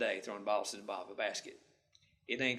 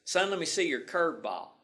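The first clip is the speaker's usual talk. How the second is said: retold coach calling across the field, raised and higher; hollered; 6 semitones higher, 5 dB louder than usual. Coach hollering at young pitcher from the fence, loud call. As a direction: call it out to the boy on the mound. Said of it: "he hollered out at me".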